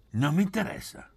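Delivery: brusque, forceful dismissal. A narrator's reading. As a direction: cut them off abruptly. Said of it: "disse Poli brusco".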